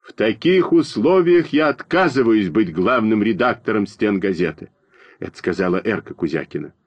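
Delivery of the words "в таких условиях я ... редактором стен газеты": plain reading voice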